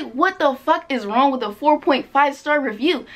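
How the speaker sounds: ordinary delivery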